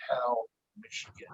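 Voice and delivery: faint, breathy whisper of a distant spirit; real quiet whisper. Retold or imitated. Ghost on the recording names the town: soft whisper. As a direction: barely breathe the words, soft and far away. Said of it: "real quiet".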